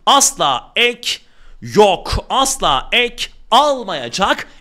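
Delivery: teacher drilling "asla ek almayacak" slowly and firmly; slow emphatic insistence. Real, not acted. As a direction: hammer home "asla", word by word. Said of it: "Ama tekrar ediyorum".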